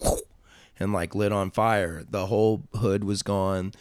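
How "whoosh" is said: breathy whoosh of flames catching; quick airy whoosh sound effect; imitated whoosh. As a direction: make the sudden rush of igniting fire. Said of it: "it just went".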